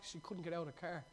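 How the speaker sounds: ordinary talk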